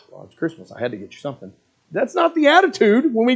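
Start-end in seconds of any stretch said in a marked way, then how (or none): 0.1-1.5 much lower, much softer sullen drag; grudging Christmas giver. Retold or imitated. Gift giver resenting a Christmas present, low and soft.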